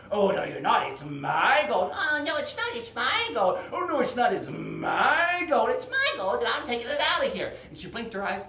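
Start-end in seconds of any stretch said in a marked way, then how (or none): 0.1-1.9 loud dragon voice, dragging out MY; arguing dragon. Acted. Dragon argues with the heroine. 1.9-3.6 high, loud retort; bickering heroine. Acted. Heroine argues back at dragon. 3.7-7.5 high, loud, stretched MY, then rushing off; insistent quarrel. Acted. Acted quarrel over the gold escalates.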